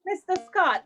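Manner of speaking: normal talking voice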